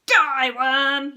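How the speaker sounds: very loud, fierce battle cry; Henry leaping up to attack Peter; furious yell. Acted. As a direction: roar it at your brother. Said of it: "yelled Horrid Henry".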